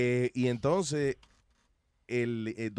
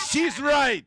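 high, loud burst after a laugh; shouted punchline; 11 semitones higher, 9 dB louder than usual. Real, not acted. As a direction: belt out the concession, laughing. Remate burlón tras la comparación. (remate gritado entre risas)

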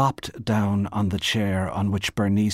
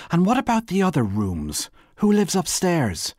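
high, loud suspicious questions; pitched-up, pressing demand; interrogating; 10 semitones higher, 5 dB louder than usual. Acted. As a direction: fire accusing questions at her. Wife interrogates her rival.

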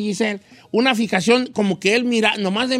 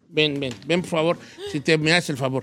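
coaxing softly; 8 semitones lower, 6 dB quieter than usual. Real, not acted. Beckons Giselle over softly.

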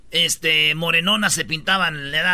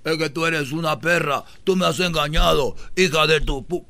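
reggaeton insult; 2 semitones lower than usual. Retold or imitated. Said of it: "yo oí los reggaetoneros".

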